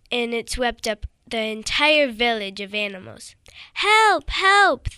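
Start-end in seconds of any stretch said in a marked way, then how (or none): 3.7-4.9 high, loud cries for help; crying for help. Acted. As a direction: call out as if the flood is taking you. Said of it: "all the animals cried".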